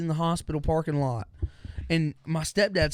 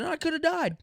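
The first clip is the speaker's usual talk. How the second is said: high-pitched whine. Retold or imitated. Exaggerated retold complaint.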